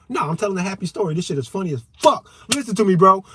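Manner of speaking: excited happy-story ranting; loud, animated. Acted. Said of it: "We talk like that".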